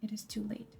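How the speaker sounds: soft aside, as if to himself; murmured aside. Acted. Claudius aside to the audience, quiet. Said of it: "Claudius aside remarks".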